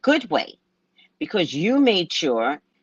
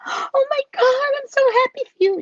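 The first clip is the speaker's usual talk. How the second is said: much higher, gushing congratulations; mock delighted squeal; 4 dB louder than usual. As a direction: squeal with fake joy.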